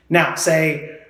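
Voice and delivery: usual speaking voice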